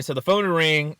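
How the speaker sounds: ordinary talk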